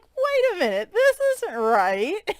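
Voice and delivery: high squeaky stork voice, slowing on the end; surprised stork. Retold or imitated. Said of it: "He's like".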